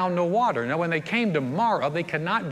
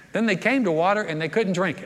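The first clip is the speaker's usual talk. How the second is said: ordinary delivery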